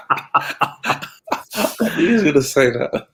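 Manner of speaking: slow, laughing repeat. Real, not acted. Co-host laughing at the predicted answer, slow laughing repeat.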